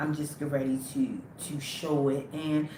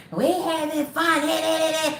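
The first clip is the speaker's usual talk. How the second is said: mock party call; 11 semitones higher, 4 dB louder than usual. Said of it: "Everybody was calling me".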